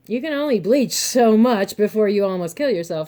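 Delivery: ordinary talk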